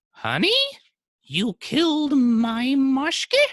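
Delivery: very high, loud, slow disbelief; shocked wife. Acted. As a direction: falsetto wife, dragging out the horrified question. Wife's shocked question to husband.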